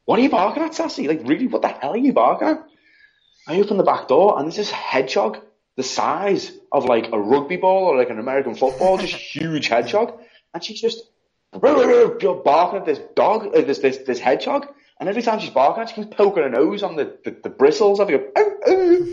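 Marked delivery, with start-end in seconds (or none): 0.0-2.6 high surprised call to the dog; pitched way up, calling the dog out; high-pitched what are you barking at. Retold or imitated. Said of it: "I'm like". 18.3-19.1 high little yelps like the dog; squeaky imitated yelping; mock yelps.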